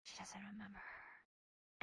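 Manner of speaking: slow, barely voiced secret; whispered. Real, not acted. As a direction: breathe it out slowly. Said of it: "I'll whisper it to you".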